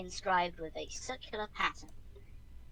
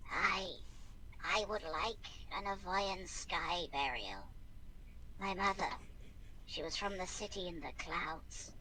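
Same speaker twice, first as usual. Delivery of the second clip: a dead soul rasping its last wish; 7 dB quieter than usual. Acted. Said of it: "emits a rasping voice".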